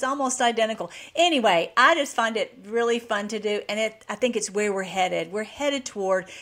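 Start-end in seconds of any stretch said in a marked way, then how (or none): none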